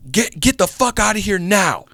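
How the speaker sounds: high, forceful warning; acted angry threat. Stranger orders the car off his property, mock angry. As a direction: imitate a property owner ordering people off. Gunman threatens to call police.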